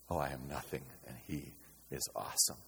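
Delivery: low, hushed awe; soft, reverent confession; hushed humility. Acted. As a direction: say it very softly and low, humbled before God. Voices a humbled response to God.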